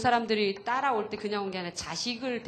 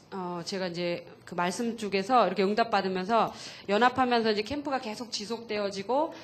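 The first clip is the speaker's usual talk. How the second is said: ordinary delivery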